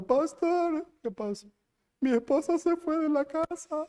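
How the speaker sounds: high-pitched tearful cry of pastor; mock tearful plea. Husband crying to the pastor, high tearful plea. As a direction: imitate a weeping husband calling the pastor.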